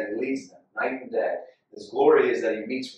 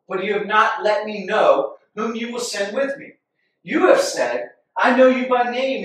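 raised, higher pleading voice; forceful plea to God; 8 semitones higher, 6 dB louder than usual. Acted. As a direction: press God hard like Moses. Reading Moses' bold plea to God.

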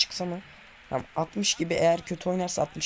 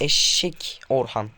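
normal talking voice

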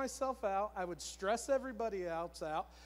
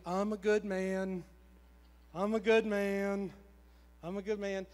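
awkward self-affirmation; 6 dB louder than usual. Retold or imitated. Husband made to say it by his wife, awkward recital. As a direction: force it out stiffly, imitating reluctant compliance. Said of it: "I felt really dumb and really weird".

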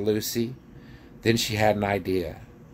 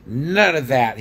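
raised, angry dwarf voice; loud, higher protest; shouting dwarf; 5 semitones higher, 6 dB louder than usual. A narrator's reading. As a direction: bark it out angrily. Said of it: "he shouted".

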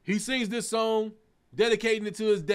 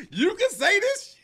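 put-on shocked kid; 12 semitones higher, 4 dB louder than usual. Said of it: "I'm like".

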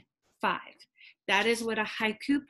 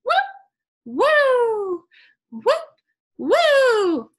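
high, loud whooping birdcall; imitating a bird; 10 dB louder, much slower than usual. Teacher imitating the funny bird at her window. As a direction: mimic a bird's whoop. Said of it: "a bird outside my window going".

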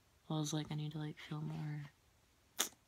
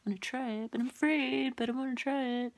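nervous inner voice; 6 semitones higher, 5 dB louder than usual. Retold or imitated. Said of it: "I was like".

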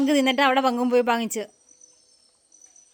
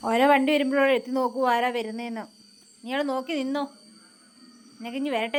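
ordinary delivery

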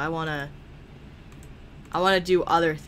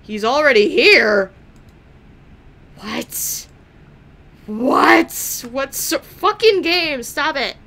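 shocked disbelief; 7 semitones higher, 6 dB louder, slower than usual. Real, not acted. Shocked he's already here.

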